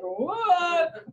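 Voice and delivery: high, drawn-out mock disbelief; baffled reaction. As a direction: stretch it out as a puzzled onlooker. Acts out baffled onlookers.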